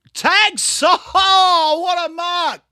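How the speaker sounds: high, loud commentator's roar; excited shout. Real, not acted. Streamer hailing Tex's mark in the game, excited shout. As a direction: cheer the big catch at full voice.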